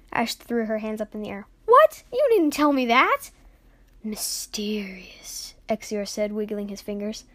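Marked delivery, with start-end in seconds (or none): 1.6-3.3 very high, loud squawk; shrill, shocked objection; outraged disbelief. Acted. Ash outraged at withheld news. 4.0-5.5 slow, whispered, drawn-out word; teasingly eerie whisper; spooky mystery. Acted. Exior teases, wiggling his fingers.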